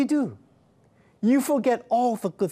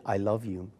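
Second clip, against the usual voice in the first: the echo, softer and lower; 6 semitones lower, 5 dB quieter than usual. Retold or imitated. Mother showing what the echo answers, softer and lower. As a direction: let it come back like a distant echo. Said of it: "Mark, that was your echo".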